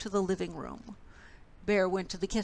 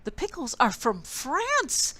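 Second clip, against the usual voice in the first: higher, proud declaring tone; grand declaration; 6 semitones higher, 4 dB louder than usual. A narrator's reading. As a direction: declare it with pride. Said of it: "declared Bear".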